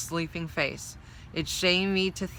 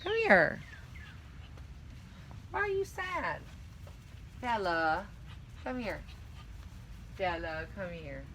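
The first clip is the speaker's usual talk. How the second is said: calling the dog; 2 semitones higher, 10 dB quieter than usual. Real, not acted. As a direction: coo at her, fading softer. Coaxing her whining dog Bella.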